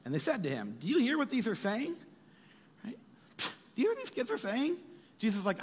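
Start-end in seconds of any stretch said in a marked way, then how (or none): 0.8-4.8 indignant leaders. Retold or imitated. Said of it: "they were indignant".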